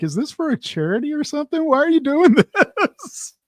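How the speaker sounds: high-pitched mock disbelief, breaking into laughter; imitated incredulity. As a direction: act out an incredulous colleague, laughing by the end. Retelling a baffled guest's question.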